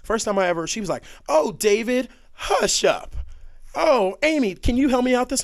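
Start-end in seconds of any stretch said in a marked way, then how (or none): 1.3-5.4 high, loud mock-casual wife voice; imitated wife. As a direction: call to relatives in a much higher voice. Imitating wife addressing relatives by first name.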